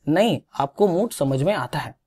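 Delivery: normal talking voice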